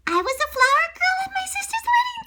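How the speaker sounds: put-on high, fast little girl voice; a proud little girl showing off. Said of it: "said Angela".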